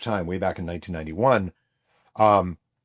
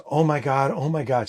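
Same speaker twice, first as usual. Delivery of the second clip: higher-pitched mock wail; half-voiced scream, pitched up; retold crying scream; 6 semitones higher than usual. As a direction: imitate an overwhelmed, crying mother. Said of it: "She's screaming and she's crying".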